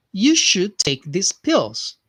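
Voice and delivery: slow, careful model line for students to repeat; unhurried teacher model sentence; slow dictation model. Shown as a demonstration. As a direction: say it slowly so learners can copy. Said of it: "repeat after me here".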